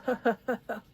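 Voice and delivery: short high chuckle; laugh. Real, not acted. Laughing between words of greeting.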